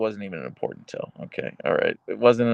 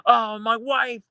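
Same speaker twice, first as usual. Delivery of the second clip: much higher, hammed-up wailing; mock despairing chatter; 12 semitones higher than usual. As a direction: play a melodramatic spouse in a panic. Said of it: "someone was like".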